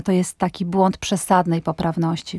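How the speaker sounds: ordinary delivery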